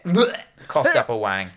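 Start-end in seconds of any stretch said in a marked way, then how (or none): 0.0-0.4 high, comic "blech" gag; mock retch. Host imitating a fish spitting out its bite, mock retch. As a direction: spit out a quick pretend retch. Imitates vomiting up the eaten part.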